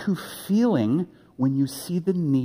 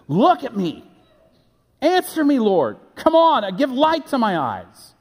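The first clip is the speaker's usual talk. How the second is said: higher and louder, demanding an answer; urgent command; 10 semitones higher, 4 dB louder than usual. Retold or imitated. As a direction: plead and order at once, like David to God. David commanding God in psalm.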